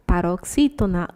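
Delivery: normal talking voice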